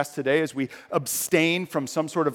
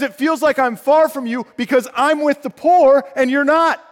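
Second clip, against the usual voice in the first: loud, high proclaiming; God declaring he is with the poor, loud; God's verdict; 10 semitones higher, 8 dB louder than usual. Acted. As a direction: declare it with full force. Preacher voices God's verdict.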